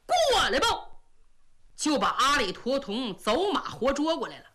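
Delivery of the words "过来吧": 用力大喊; shout. A narrator's reading. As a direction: yell it as you yank him off the horse. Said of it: "嘴里喊着".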